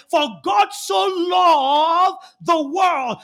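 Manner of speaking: slow, loud, drawn-out proclamation; preacher booming the key verse at the congregation; preaching at full force. Acted. Slow climactic verse quote.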